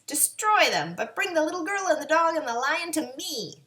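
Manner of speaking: high put-on witch voice; wicked witch. A narrator's reading. Witch orders her winged monkeys, witch voice.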